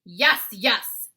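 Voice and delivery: loud booming giant voice. Acted. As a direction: boom it out like a brute giant. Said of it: "boomed the blood bottler".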